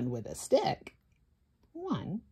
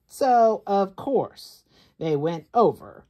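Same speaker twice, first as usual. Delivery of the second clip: rising louder telling; louder build; 3 semitones higher, 9 dB louder than usual. A narrator's reading. Storyteller loudly building tension for the child. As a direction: push the suspense up.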